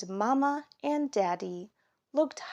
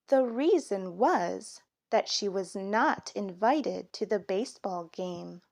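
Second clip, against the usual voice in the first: plain reading voice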